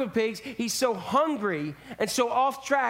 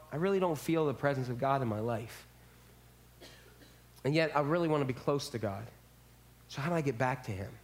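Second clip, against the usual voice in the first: quiet longing; 7 semitones lower, 5 dB quieter, faster than usual. Retold or imitated. Voicing the doubter.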